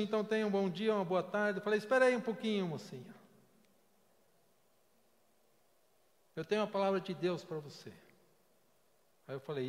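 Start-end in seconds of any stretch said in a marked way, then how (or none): 0.0-1.6 operator voice. Retold or imitated. Operator signing off. 1.8-7.9 urgent call. Retold or imitated. Stopping the operator.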